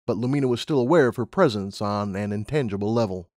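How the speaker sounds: ordinary delivery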